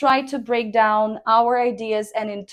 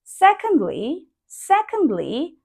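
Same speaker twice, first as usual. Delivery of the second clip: secondly with a tick shape, dip then rise; low falling tone; 6 semitones higher than usual. Shown as a demonstration. Teacher demonstrating the tick-shaped tone to students. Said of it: "you pronounce it something like".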